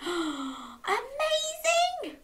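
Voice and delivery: high, soft, very slow wonder; gasp, then stretch it out softly; awed amazement. Acted. Storyteller marvels at rainbow fish, hushed amazement.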